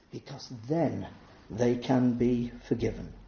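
normal talking voice

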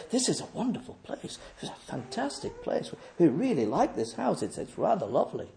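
very soft, polite house-guest murmur; admiring visitors. Retold or imitated.

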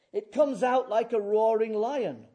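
loud, swelling preacher's roar; lion-like roar. Acted. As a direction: boom it out like a roaring lion. Illustrates the angel's roaring shout.

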